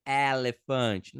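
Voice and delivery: slow "elefante" with an open é, "é-lefante"; joking slow wrong pronunciation; exaggerated open é. Shown as a demonstration. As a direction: stretch "elefante" comically. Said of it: "Ninguém fala".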